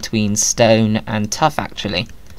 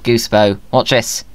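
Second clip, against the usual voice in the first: normal talking voice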